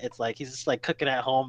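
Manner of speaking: ordinary delivery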